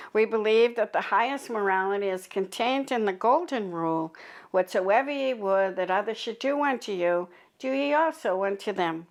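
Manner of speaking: ordinary delivery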